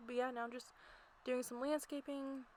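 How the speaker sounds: ordinary talk, nothing marked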